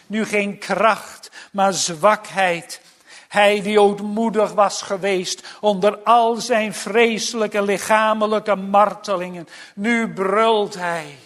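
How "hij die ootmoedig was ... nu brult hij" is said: verheven, nadrukkelijke preekstem; bevlogen preek. Acted. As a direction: preek met kracht naar het slotwoord toe. (bevlogen en nadrukkelijk prediken)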